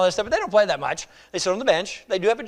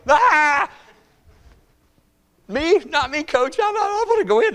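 mock panicked player; 8 semitones higher, 3 dB louder, slower than usual. Bench player panics when called.

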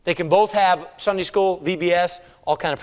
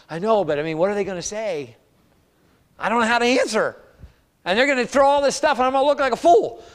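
fearful worrying; 6 semitones higher than usual. Acted. Believer dreading questions when sharing the Lord, fearful. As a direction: worry rising higher as it goes. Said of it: "sometimes that makes us fearful".

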